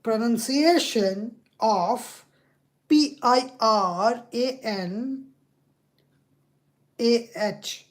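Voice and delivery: slow, letter by letter; slow spelling out. Real, not acted. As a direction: dictate each letter carefully to a machine. Spelling a query for assistant.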